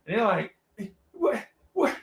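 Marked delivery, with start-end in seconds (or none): 1.1-2.0 very high mock stammer; baffled disbelief. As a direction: imitate grown musicians utterly lost. Musicians baffled by writing raps.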